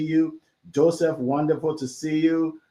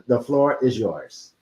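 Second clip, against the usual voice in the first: ordinary talk, nothing marked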